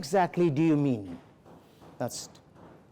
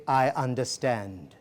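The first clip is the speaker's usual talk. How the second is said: soft, slow, lower "I understand."; calm acknowledgement; 7 semitones lower, 4 dB quieter, slower than usual. Shown as a demonstration. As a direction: reassure him gently after a pause. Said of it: "I just pause for a second".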